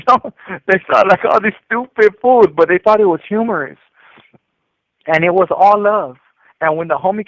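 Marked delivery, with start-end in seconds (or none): 0.1-2.5 higher, louder, through a laugh; amused laughing. Real, not acted. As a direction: let the laugh break into the telling. Amused retelling of others finding it funny.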